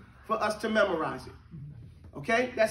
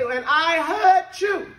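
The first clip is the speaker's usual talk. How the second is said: put-on slow, higher, louder childlike line; imitating children; 5 semitones higher, 8 dB louder, much slower than usual. Teacher imitating how children say it, childlike. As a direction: mimic a child saying it. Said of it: "what the children do, they say".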